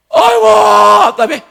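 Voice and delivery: 크게 외치는 답답한 탄식; 훨씬 높고 크게 아이고; exasperated cry. Real, not acted. Exasperated outburst in sermon. (답답해서 크게 외치는 탄식)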